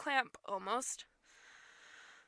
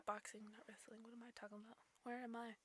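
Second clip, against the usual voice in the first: hushed whisper to self; 22 dB quieter, faster than usual. Real, not acted. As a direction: mutter it under breath.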